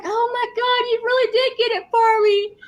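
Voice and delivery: high, tearful imitation of overwhelmed gratitude; pretend-crying in a high voice; mock crying. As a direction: imitate someone tearing up over a gift. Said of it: "Then he starts crying".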